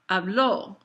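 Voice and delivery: "habló" stressed on the LÓ; stress on final syllable. Shown as a demonstration. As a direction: lean slowly on the last syllable of "habló". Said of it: "emphasize that final syllable".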